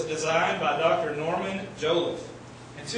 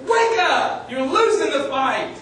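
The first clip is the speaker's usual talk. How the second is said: much higher and louder, slow shout; trainer shouting; 7 semitones higher, 8 dB louder, slower than usual. Retold or imitated. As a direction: yell to snap him out of it. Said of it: "He says".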